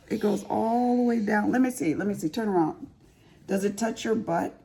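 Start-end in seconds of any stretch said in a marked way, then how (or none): none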